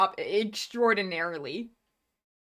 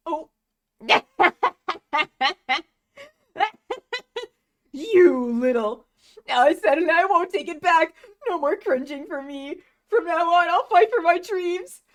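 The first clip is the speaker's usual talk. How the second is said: voicing manga characters; 9 semitones higher, 7 dB louder than usual. Reads manga aloud voicing characters.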